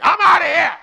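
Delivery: high, loud storming-out yell; acted angry outburst. Someone making a decision when angry, acted furious yell. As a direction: burst out as if walking out. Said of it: "when you are angry".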